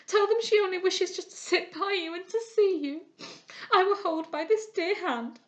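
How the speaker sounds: high, loud weeping plea; cling and beg through tears; girl begging her friend to speak for her; desperate pleading. A narrator's reading. Begs unconscious friend for help.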